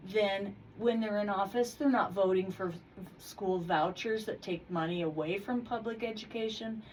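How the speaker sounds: normal talking voice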